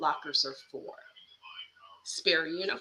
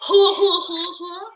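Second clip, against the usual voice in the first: high hooting noise; high-pitched hoo-hoo mimicry; mock hooting; 8 semitones higher, 8 dB louder than usual. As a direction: imitate a goofy hoot, high. Mimicked hooting after the rant.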